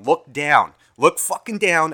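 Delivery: loud, insistent self-command; urgent inner warning. Retold or imitated. Brain warning the distracted owner, urgent. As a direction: shout the alert to yourself, slowing on the repeat. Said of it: "my brain is going".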